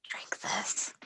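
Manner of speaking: hushed, voiceless aside; whisper. Acted. Player slips Cricket a booster drink, whispering. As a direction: slip it to them in a secret whisper. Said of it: "I'm just gonna whisper".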